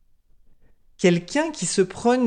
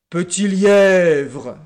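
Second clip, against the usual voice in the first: loud, drawn-out lion growl; grumpy, stretched-out summons; grumbling lion; 7 dB louder, much slower than usual. A narrator's reading. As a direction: growl the name slowly as a grudging king. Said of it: "dit le lion en maugréant".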